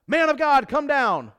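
loud, high shout of a captain; commanding summons. Retold or imitated. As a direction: call him down from the hill.

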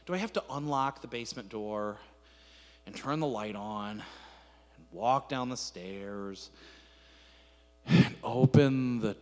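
low, slow, dragged-out complaint; hammed-up reluctant whine to a spouse, slow and low; weary reluctance. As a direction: drag each step out wearily, sinking low. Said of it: "We add steps to the process".

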